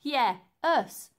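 loud, snorted joke; snorting George. A narrator's reading. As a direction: snort it out cheekily. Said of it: "snorted George".

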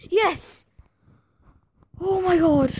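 high, loud cry of victory; triumphant shout. Real, not acted. Gamer landing the signing on deadline day, triumphant shout. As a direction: explode with joy.

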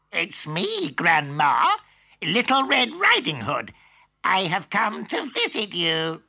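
wolf faking a high squeak; squeaky voice. Acted. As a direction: squeak like a little girl. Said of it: "in a squeaky voice".

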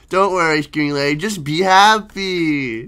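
normal talking voice

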